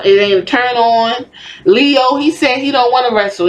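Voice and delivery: ordinary delivery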